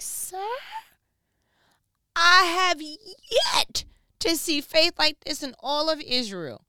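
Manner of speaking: high, loud, slow crying outburst; amazed outburst. Retold or imitated. Preacher as Jesus, amazed at the soldier's faith, loud and high. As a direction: marvel at his faith with a break.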